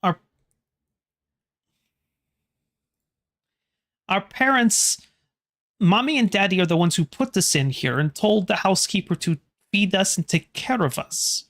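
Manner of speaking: a hint of a small ghost girl who doesn't understand; confused ghost child. Ghost girl puzzled by the visitors, light childlike voice.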